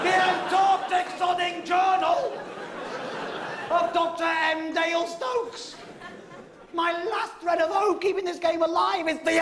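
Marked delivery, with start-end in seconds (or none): 0.0-5.8 mock grand repeat. Real, not acted. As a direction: savour every word of the title.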